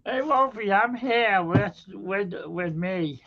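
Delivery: high, slow, drawn-out ghostly call; spooky ghost voice. Pretend. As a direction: play a playful spirit calling out slowly. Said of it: "Just trying to be funny".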